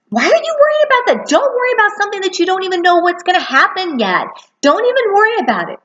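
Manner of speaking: high-pitched motherly lecture; sharp mom nagging. Retold or imitated. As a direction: brush off his worry like an anxious mom. Mom scolding worried son in car.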